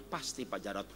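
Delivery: low, soft wounded wife; low, soft wife confessing; wife's voice. Acted. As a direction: answer quietly, sure of it. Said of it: "suami saya selingkuh".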